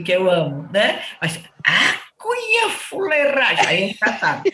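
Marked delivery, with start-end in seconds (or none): 1.7-3.7 "cunhã fuleiragem" slow, higher and scornful; insulting a woman, slow disdainful put-down; pejorative tone. Shown as a demonstration. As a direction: drag out "A cunhã fuleiragem" with contempt. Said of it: "depende da entonação".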